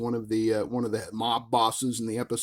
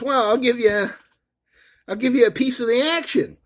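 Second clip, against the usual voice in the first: higher, louder mock Chicago mobster; Kirk as gangster; 10 semitones higher, 6 dB louder than usual. Kirk bargaining with the kid, a hammed-up Chicago mobster voice. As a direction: sell the deal like a wiseguy, pitched up and loud. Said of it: "and Kirk goes".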